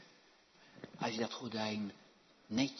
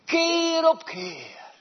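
much higher, stretched out word by word; slow, loud, high preaching cry; 14 semitones higher, 10 dB louder, much slower than usual. Acted. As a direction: hammer each word home slowly. Preaching climax.

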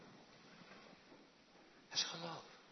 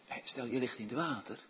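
ordinary talk

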